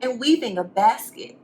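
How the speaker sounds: lively, sing-song storybook reading; reading to children. A narrator's reading. Teacher reading a picture book to the class. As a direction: read it to the kids with rhyme.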